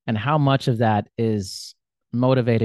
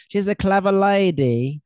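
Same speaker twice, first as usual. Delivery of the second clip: drawn-out, higher-pitched fake British accent; mock British accent; 5 semitones higher than usual. Predicting what listeners will say, in a mock British accent. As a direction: put on an American's British accent. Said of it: "they love British accents".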